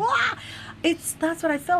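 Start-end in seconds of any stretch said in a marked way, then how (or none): 0.0-0.4 high-pitched mock "wah" cry; horrified wail. Man in Poltergeist tearing his face apart, horrified wail. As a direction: imitate a film character's helpless wail. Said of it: "he can't stop".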